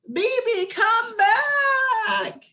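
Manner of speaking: high, loud, crying call after him; desperate scream. Acted. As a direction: scream for your brother to come back. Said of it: "I screamed".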